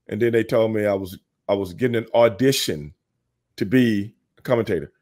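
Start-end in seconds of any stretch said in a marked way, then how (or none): none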